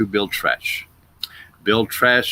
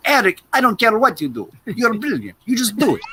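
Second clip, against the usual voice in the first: imitating director; 10 semitones higher than usual. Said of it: "Andrei Konchalovsky, who's Russian".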